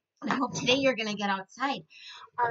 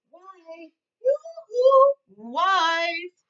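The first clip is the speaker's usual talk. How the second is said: high, loud sing-song call to the wife; 8 semitones higher, 9 dB louder, much slower than usual. Acted. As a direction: call her from across the field. Farmer calls his wife.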